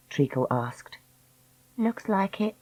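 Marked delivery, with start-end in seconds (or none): 1.8-2.5 resigned, softer reply; flat. Acted. Dakota answering that they are trapped, flatly. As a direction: say it quietly, without hope. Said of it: "Dakota said flatly".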